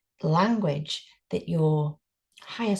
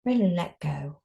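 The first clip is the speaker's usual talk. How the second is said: guided meditation. Acted. Teacher guiding a group through breathing, calm meditation voice. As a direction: ease them into stillness.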